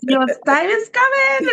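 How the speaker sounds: rising into a high, excited scream; jubilant shouting. Retold or imitated. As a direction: shout it up high in thankful joy. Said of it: "I started shouting and screaming".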